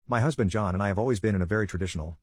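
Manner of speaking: usual speaking voice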